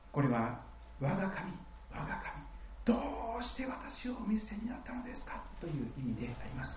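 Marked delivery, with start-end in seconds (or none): 1.0-5.4 soft pleading. Retold or imitated. Translating Jesus' cry.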